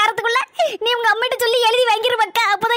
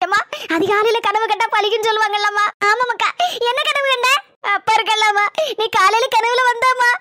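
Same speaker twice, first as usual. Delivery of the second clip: ordinary delivery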